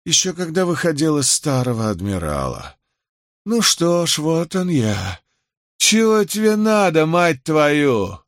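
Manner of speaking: put-on high-pitched, aggressive drunk; drunken belligerence. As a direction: demand an answer, rising to an angry cry. Drunk Osley confronts Matthew.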